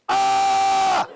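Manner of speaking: loud, high held "ah" yell; sudden high-pitched wail; heckler's cry. Acted. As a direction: yelp like a zapped heckler. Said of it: "Look for the guy going like this".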